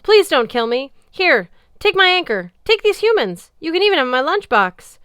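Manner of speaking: high, loud, desperate begging; wailing plea. Acted. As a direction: beg like a coward about to die. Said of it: "Harald wailed".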